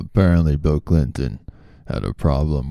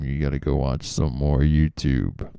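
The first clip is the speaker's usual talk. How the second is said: ordinary talk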